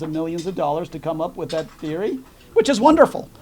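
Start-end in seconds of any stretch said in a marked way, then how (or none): 2.5-3.3 high, mocking praise; sarcastic. Real, not acted. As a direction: say it with biting irony. Sarcastic aside about academic theory.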